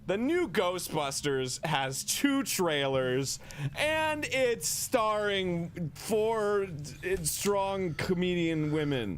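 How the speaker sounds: slow, high mock-outraged declaiming; angrily spouting facts. Mock movie reviewer stating trailer facts, hammed-up anger. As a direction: drag out each fact in hammed-up indignation. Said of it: "me angrily spouting facts".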